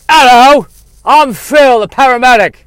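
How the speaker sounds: high, loud Irish paramedic voice; brash Irish paramedic; Irish accent. Acted. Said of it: "He's from either England or Ireland, or the Bronx".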